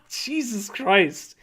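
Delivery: slow, drawn-out disbelief; stunned. Real, not acted. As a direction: let it sink in slowly. Reacting to a shocking donation message.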